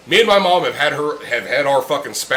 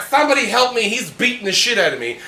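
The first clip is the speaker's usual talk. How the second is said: mock whiny plea; 7 semitones higher than usual. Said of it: "He's like".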